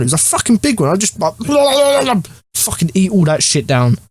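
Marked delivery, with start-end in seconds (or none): 1.4-2.2 sudden giggle mid-story; high laugh. Real, not acted. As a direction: burst of high-pitched laughter. Laughing at own joke.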